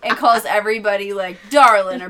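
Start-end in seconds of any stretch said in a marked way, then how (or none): 1.5-2.0 loud mock Southern pet name; southern accent. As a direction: punch it out with a Southern twang. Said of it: "he has a southern accent".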